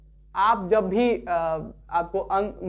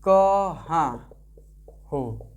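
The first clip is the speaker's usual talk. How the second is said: drawn out; 3 semitones lower, much slower than usual. Shown as a demonstration. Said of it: "तो जैसे आप लिखते हैं".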